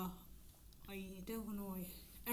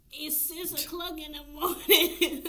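laughing complaint; 6 semitones higher, 7 dB louder than usual. Retold or imitated. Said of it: "he was saying, like".

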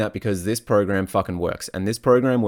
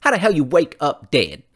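high put-on comedy voice quoting a film; goofy high-pitched movie impression with a laugh; Scary Movie quote; 9 semitones higher than usual. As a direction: squeak it out like a silly movie character, cracking up at the end. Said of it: "One of my favorite scenes from Scary Movie".

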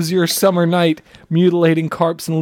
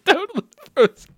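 laughing; 10 semitones higher than usual. Real, not acted. Laughing echo of tagline.

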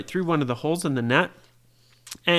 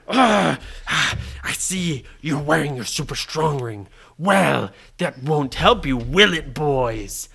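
a hint of sneering pirate captain; grunt, then scoff lightly in character; lightly voiced pirate villain; 4 semitones lower than usual. Villain noticing the boy's strong ring, lightly voiced pirate. Blackbeard answers the strong boy.

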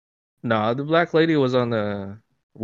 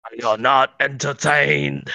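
slow, declamatory gladiator challenge; Russell Crowe; slower than usual. Acted. Answering the entertaining-podcast question, slow movie-hero impression. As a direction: ring it out slowly like an arena hero. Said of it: "That's a Russell Crowe".

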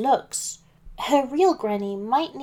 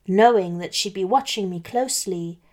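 usual speaking voice

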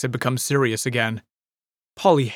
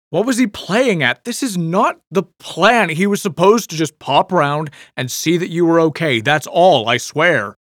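louder, higher protesting rant; agitated outburst; 5 semitones higher, 5 dB louder than usual. A narrator's reading. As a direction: insist hard that this was never the plan. Bert upset about broken plan.

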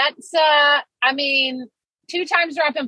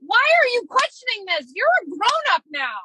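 scolding herself; 7 semitones higher than usual. Retold or imitated. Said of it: "so mad at myself".

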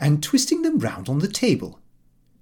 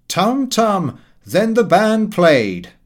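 Frank's louder, higher jokey chant, slowed down; drawn-out music-hall tease, slow; playful sing-song; 7 semitones higher, 7 dB louder, slower than usual. A narrator's reading. Frank teasing the dinner guests, slow sing-song. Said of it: "Frank jumped up and said".

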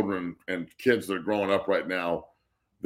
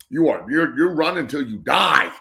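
loud, higher-pitched drill order; forceful raised-voice command; sergeant's shout; 5 semitones higher, 7 dB louder than usual. Acted. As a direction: yell it like a punishing sergeant. Acting as the sergeant.

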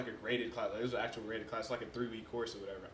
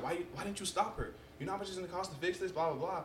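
mom scolding; 7 semitones higher than usual. Retold or imitated. Mom scolding him for not stopping sister.

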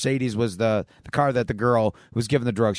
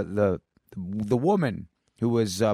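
ordinary talk, nothing marked

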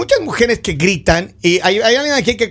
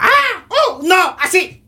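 high-pitched mock screams at football; imitated screaming fan; 9 semitones higher, much slower than usual. Father imitates his daughter screaming at football, mock shrieks. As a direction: squeal like an overexcited daughter. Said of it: "esa gritona".

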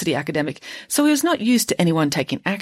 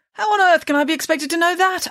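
baffled protest; 13 semitones higher than usual. Acted. Father baffled, indignant.

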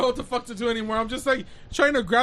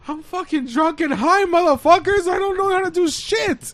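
higher and louder, fed up; exasperated outburst; 6 semitones higher, 4 dB louder than usual. Retold or imitated. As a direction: blurt it out exasperated. Exasperated retort, pitch up.

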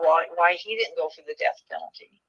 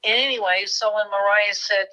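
usual speaking voice

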